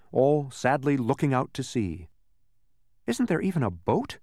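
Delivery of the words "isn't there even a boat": high, anxious question. A narrator's reading. Milo wanting to get on, anxious. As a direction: ask with a touch of worry. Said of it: "anxious to get on with his trip".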